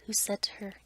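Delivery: usual speaking voice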